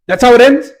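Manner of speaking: shot way up in pitch, loud disbelief; can't believe that's the ending; incredulous. Real, not acted. Reviewer reacts to the finale in loud disbelief.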